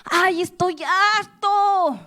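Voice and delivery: put-on high, whiny child's complaint; childish protest. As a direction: whine it like a sulking kid. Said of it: "cómo protestan los niños".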